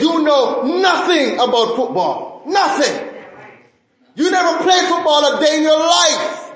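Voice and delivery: high, mock shouted put-down; hammed-up shouting put-down. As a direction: yell it like an outraged fan. Mock rebuke of Tom Brady.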